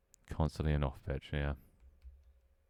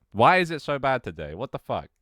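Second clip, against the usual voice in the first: exasperated; 9 semitones higher, 8 dB louder than usual. Real, not acted. Frustrated at own cracking voice.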